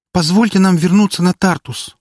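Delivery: high, loud eager plea; exclaiming. Acted. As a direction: call out all together, eager. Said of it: "хором воскликнули ламирии".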